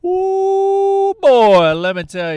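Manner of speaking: very high, very loud delighted squeal; thrilled high yelp over the sandwich; excited ooh. Real, not acted. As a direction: burst out with glee. Excited about homemade mayonnaise.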